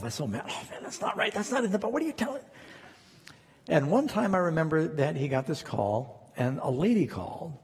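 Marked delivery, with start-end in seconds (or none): 0.0-2.4 retold frustrated grumbling, half under the breath; a hint of indignant muttering to himself; exasperated muttering. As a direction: mutter at the radio in disbelief. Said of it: "just talking to myself".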